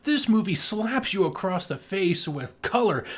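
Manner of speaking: ordinary delivery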